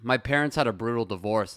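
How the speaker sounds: usual speaking voice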